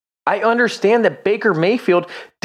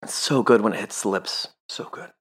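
savoring a sip of bourbon; low, right after a drink; while sipping a drink; 8 semitones lower, 6 dB quieter than usual. Real, not acted. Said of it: "Let me take a sip of this".

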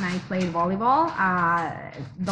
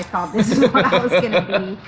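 laughing while speaking; 6 dB louder than usual. Real, not acted. Laughing at her past plans.

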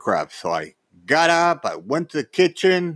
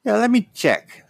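eager repeat; 10 semitones higher than usual. Retold or imitated. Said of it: "He goes".

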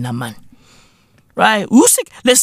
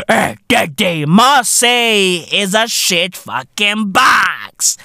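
ordinary talk

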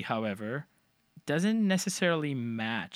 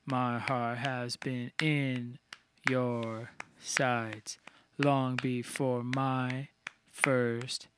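slow, measured words placed squarely on the beat; every word on beat; much slower than usual. Shown as a demonstration. Showing how bridge words lie on the beat, slow rhythmic delivery. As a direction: stretch each word out to the count. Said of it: "on the beat".

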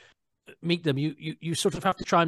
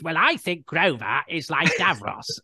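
slow, pompous mock actor voice; a lightly hammed theatrical drawl; a hint of a grand old actor holding forth; imitating [name] Miles; 3 semitones higher, 3 dB louder, slower than usual. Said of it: "[name] was doing his".

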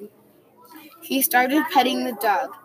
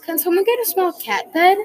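eager ask; 8 semitones higher, 4 dB louder than usual. A narrator's reading. Kid calls out to family.

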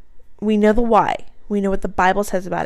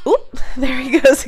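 amused laugh, pitch up and louder; laughing at interruption; 4 semitones higher, 5 dB louder than usual. Real, not acted. Mother laughing as her baby cuts in again.

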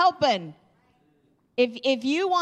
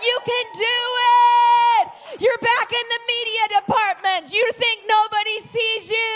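shouted, pitched way up, real church hype; 9 semitones higher, 6 dB louder than usual. Real, not acted. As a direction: roar encouragement at the crowd. Pastor hyping congregation, media team.

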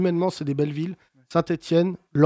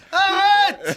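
high-pitched squeal of laughter; impression of a friend yelling; 4 dB louder than usual. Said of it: "Il disait".

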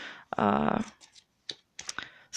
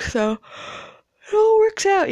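words stretched slowly through a yawn; 12 semitones higher, 3 dB louder, much slower than usual. Real, not acted. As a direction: talk through a big yawn. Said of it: "Sorry to yawn".

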